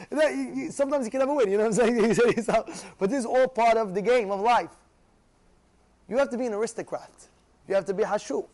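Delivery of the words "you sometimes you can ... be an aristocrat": normal talking voice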